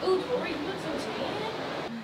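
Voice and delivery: ordinary talk, nothing marked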